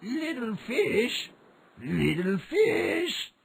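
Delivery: slow, high call over the water. A narrator's reading. Old man calls the fish at the sea, slow and high. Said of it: "the man called out".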